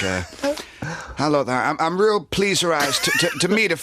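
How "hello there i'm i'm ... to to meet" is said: a hammed-up posh greeting to woo a lady; silly wooing voice. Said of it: "You can't sort of come along and sort of like".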